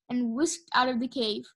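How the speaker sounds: usual speaking voice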